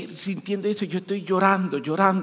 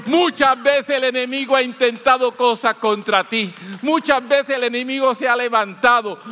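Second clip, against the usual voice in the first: loud, insistent sermon delivery; preaching; 4 semitones higher, 5 dB louder than usual. Real, not acted. Preacher appeals loudly to the listeners. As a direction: press the message on the congregation with force.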